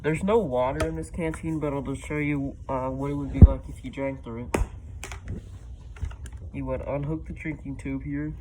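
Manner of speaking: muffled voice inside a mask; through a gas mask. Real, not acted. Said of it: "show you guys what it's like with me in it".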